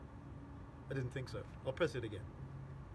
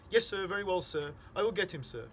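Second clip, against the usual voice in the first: flustered deference; 4 semitones higher, 5 dB louder than usual. A narrator's reading. Nervous father obeys caller on phone.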